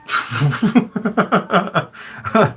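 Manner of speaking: long high real laugh. Real, not acted. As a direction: burst out laughing at the story. Laughs at old lady cat story.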